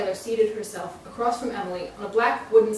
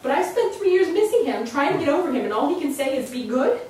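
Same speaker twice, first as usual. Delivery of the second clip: much higher and louder, wounded; hurt outburst; 7 semitones higher, 8 dB louder than usual. Acted. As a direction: let the grief spill into anger.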